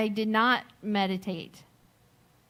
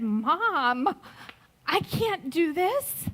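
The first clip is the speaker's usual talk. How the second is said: put-on higher, slow, frightened boy's plea; scared son; 5 semitones higher, much slower than usual. Twelve-year-old son refusing to drive the stuck car, frightened plea. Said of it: "with these scared little eyes".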